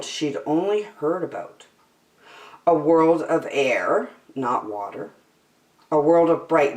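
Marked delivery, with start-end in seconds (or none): none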